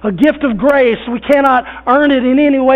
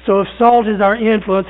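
usual speaking voice

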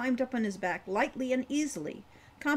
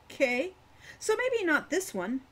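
amiable; 8 semitones higher, 3 dB louder than usual. A narrator's reading. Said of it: "she amended amiably".

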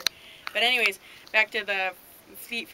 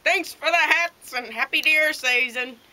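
loud, high shouted thanks across the store; cheerful parting yell; 8 semitones higher, 9 dB louder than usual. Retold or imitated. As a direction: call it out loud as you leave. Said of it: "So I yelled".

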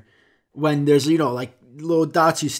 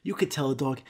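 ordinary delivery

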